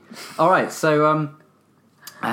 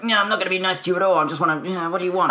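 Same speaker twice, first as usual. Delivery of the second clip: suspicious brush-off; 5 semitones higher, faster than usual. Retold or imitated. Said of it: "you might give that screwed up face".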